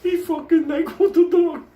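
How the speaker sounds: very high, loud, squeaking through laughter; helpless laughter. Real, not acted. Storyteller loses it over the NyQuil, helplessly laughing. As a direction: laugh so hard the words barely come out.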